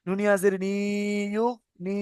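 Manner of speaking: "নি" with a lengthened ee; drawn-out madd. Shown as a demonstration. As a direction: stretch the vowel in "নি". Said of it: "একালি পরিমাণ টান দিয়ে পড়তে হয়".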